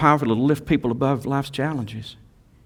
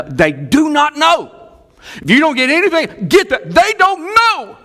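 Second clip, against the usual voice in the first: emphatic shout; 5 dB louder than usual. Real, not acted. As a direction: shout it so nobody misses it. Preacher insisting on the point.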